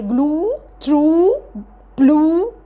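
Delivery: "جلو ترو، بلو" each with a long "oo"; double-O sound. Shown as a demonstration. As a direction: stretch the final vowel of "جلو ترو، بلو". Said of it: "لا اما دبل او". (نطق "جلو ترو، بلو" بواو ممدودة)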